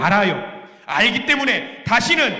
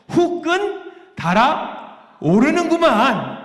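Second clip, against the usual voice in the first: slow, higher, drawn-out Jeolla drawl; hammed-up Jeolla dialect; 7 semitones higher, much slower than usual. Shown as a demonstration. Preacher milks the Jeolla comedy catchphrase slowly for the congregation. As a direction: stretch the Jeolla catchphrase out for laughs. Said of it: "이거를 뭐냐면 이렇게 말해요".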